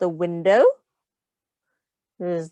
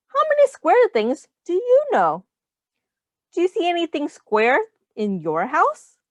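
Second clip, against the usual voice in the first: excited question; 8 semitones higher than usual. Acted. Reads the book's question to kids.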